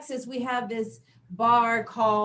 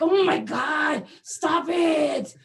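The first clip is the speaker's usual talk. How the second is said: exasperated shout; 4 semitones higher, 5 dB louder, much slower than usual. Retold or imitated. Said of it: "I'm like".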